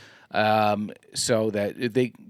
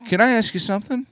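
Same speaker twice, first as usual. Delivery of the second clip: lightly mock-polite, pitched up; mock polite question; 10 semitones higher than usual. Retelling his sly question to the scammer, mock-polite. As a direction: sweetly set the trap. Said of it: "and I said".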